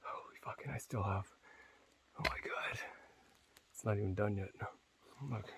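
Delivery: breathless whisper, gasping for air; panting between the words; out of breath. Real, not acted. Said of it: "I'm huffing and puffing".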